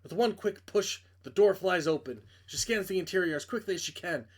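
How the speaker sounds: plain reading voice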